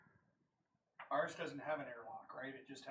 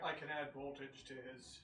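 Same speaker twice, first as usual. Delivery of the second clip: normal talking voice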